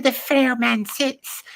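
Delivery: high, slow creaky Gollum narration; imitating Gollum, lightly hammed-up; mock Gollum. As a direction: drag it out like Gollum. Gollum voice narrating frail man.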